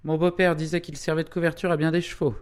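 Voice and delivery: high, fast, put-on character voice; quick, high-pitched outburst; exclaiming. A narrator's reading. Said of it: "s'écria Minoret Levrault".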